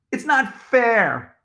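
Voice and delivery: high, drawn-out complaint; aggrieved childish whine; whiny protest. A narrator's reading. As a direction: stretch it out like a sulking kid. Boys protest jealously.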